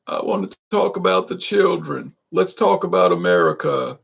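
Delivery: mock a politician's rehearsed sincerity; put-on stump-speech delivery; affected politician. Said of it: "No one in real life talks like that".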